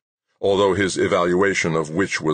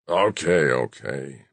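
grudging grumble, sinking on the second word; grumbling; 3 semitones lower, slower than usual. A narrator's reading. Boss giving in to his lawyer, grumbling. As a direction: concede it grumpily. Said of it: "McGrath grumbled".

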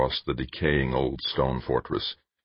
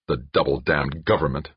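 usual speaking voice

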